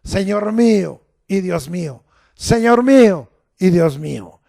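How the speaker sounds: preacher leading a call-and-response; declaring aloud. Shown as a demonstration. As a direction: say it with the congregation. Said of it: "Dígalo".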